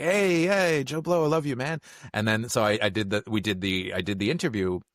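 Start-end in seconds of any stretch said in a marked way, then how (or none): 0.0-1.8 high-pitched, effusive hello; Bob Odenkirk greeting. Retold or imitated. Actor greeting the interviewer he likes, excited and affectionate. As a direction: imitate a star gushing with affection for an acquaintance. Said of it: "and Odenkirk was like".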